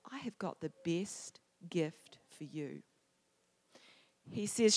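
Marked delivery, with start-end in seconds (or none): 0.0-2.8 low, soft and slow; tender divine voice. Retold or imitated. God looks down at Kim, tender reassurance. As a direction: speak slowly and gently, as if from above. God's answer, quoted.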